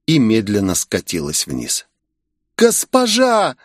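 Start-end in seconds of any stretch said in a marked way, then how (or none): 2.6-3.6 much higher voice crying out; anguished cry. Acted. Sayori cries to her mistress, anguished. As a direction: call out in anguish.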